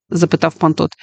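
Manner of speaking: ordinary delivery, nothing marked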